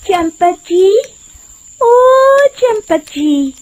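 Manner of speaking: high, drawn-out singsong call; luring woman calling out. Acted. Disguised witch calls to the passing merchant, luring. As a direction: call his name sweetly, stretching it out.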